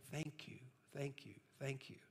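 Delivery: soft, murmured thank-yous fading away; a gentle, lightly voiced murmur of gratitude; hushed repeated thanks. Grateful man murmuring thanks as he walks away, softly.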